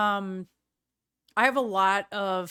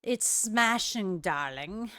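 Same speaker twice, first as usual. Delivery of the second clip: slow, drawn-out posh British "smashing, darling"; a light posh British accent, savouring "smashing"; slower than usual. Shown as a demonstration. A vlogger to her viewer, in a mild posh British accent. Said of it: "kind of an accent that I did".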